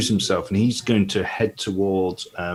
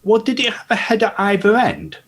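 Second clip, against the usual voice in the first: put-on higher, curious boy's voice; young lad voice; 13 semitones higher, 3 dB louder than usual. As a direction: ask it like a wide-eyed lad. Gully asks naive question.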